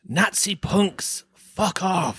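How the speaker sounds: high, very slow imitated yell; a little boy bellowing from a slide; mock child shout.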